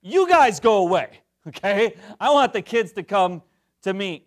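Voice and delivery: high, loud mock-indignant Jesus; indignant shout. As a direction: shoo the grown-ups off, loudly. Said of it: "He gets indignant".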